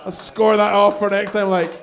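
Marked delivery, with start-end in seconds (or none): none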